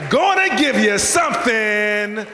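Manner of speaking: drawn-out, big catchphrase call; catchphrase shout. Real, not acted. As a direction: sell the signature line to the crowd. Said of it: "as I always say".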